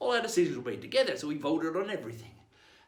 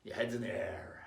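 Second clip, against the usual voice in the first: usual speaking voice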